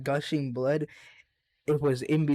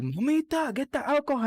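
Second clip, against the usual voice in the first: higher mock call across the yard; dad calling out; 12 semitones higher than usual. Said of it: "And then he's like".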